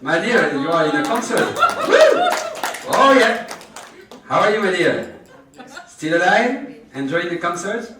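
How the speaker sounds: loud, high cheering with a laugh; cheering a patient who just sang, loud and high; excited concert cheer. Real, not acted. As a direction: whoop it up like a fan at a gig.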